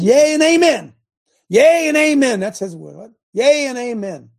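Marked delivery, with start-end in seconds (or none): 0.0-2.4 high, loud fervent cry; shouted proclamation. Real, not acted. Preacher shouting the promise to his flock. 3.3-4.3 high, loud amen cry; loud proclamation. Real, not acted. Preacher repeating his cry to the congregation. Repeats shout after What?.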